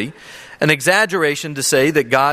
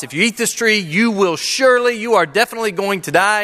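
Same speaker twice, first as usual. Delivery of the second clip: raised, stressed threat of death; emphatic warning; 6 semitones higher than usual. Shown as a demonstration. Preacher voicing God's warning, strongly emphatic. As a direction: lean hard on surely. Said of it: "the most emphatic way you can get across".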